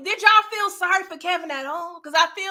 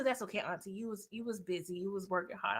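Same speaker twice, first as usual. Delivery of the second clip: a soft, lower, kind nephew voice; gentle nephew; 8 semitones lower, 14 dB quieter than usual. Retold or imitated. Nephew softly forgiving Auntie for dozing off, lower and soft. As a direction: soothe Auntie quietly. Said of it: "he's like".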